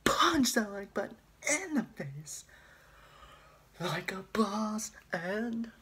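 high, loud Irish YouTuber shout; Jacksepticeye impression. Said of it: "I can't do that".

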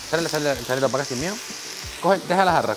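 ordinary delivery

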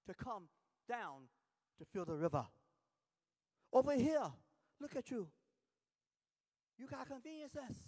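imitated Nigerian accent building to loud insistence; Mbumi, Nigerian accent. Nigerian friend explaining river life back home, insistent imitated Nigerian accent. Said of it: "And in his accent of Nigerian".

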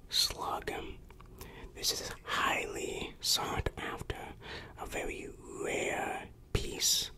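ordinary delivery

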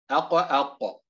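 أق، أق with a strong echo on the q; أق، أق with a full qalqala release; stronger qalqala. Shown as a demonstration. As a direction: bounce the final ق of أق hard, twice. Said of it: "التمييز بين مراتب القلقلة".

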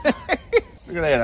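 normal talking voice

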